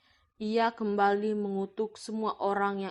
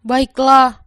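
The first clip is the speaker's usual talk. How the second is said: put-on higher, louder young man's voice; earnest Arya; 4 semitones higher, 17 dB louder than usual. Arya shows his wife the gold, higher and louder. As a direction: lift the pitch and project as the younger brother.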